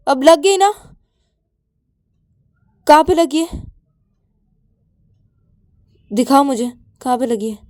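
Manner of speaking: loud, high-pitched exasperated scolding; angry scolding. Acted. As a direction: scold a hurt friend in a temper. Angry Ananya scolds hurt Payal.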